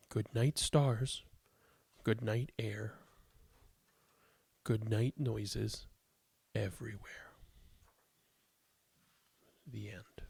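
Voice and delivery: gentle bedtime story read, slow and clear; reading to children. Acted. Reads picture book aloud.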